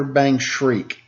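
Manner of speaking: ordinary delivery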